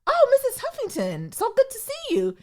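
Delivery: high, starstruck politeness; high, sweet voice greeting a rich woman; fawning greeting. Retold or imitated. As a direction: gush at her politely. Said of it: "I was like".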